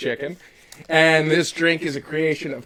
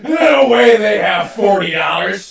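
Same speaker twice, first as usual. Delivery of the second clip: mock outraged shout; 6 semitones higher, 8 dB louder than usual. Joking outrage over bottle price.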